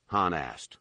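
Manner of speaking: usual speaking voice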